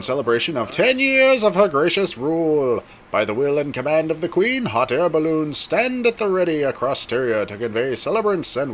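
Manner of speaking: high, ringing herald's announcement; royal proclamation. Acted. Herald invites guests to the Queen's Jubilee, formal proclamation. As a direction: proclaim it grandly to the Queen's subjects.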